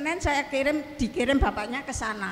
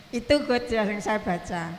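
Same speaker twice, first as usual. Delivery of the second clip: ordinary talk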